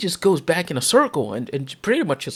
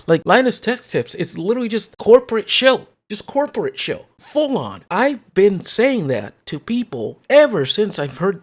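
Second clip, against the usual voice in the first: heated rant; 5 semitones higher, 6 dB louder than usual. Real, not acted. Ranting about a YouTuber.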